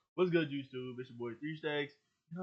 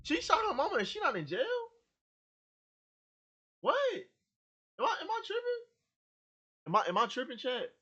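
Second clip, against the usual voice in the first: shocked; 13 semitones higher, 3 dB louder than usual. Real, not acted. Shocked at clip.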